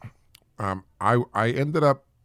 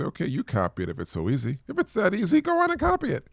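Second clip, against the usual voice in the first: challenging; 9 semitones higher than usual. Retold or imitated. Challenging critics to copy.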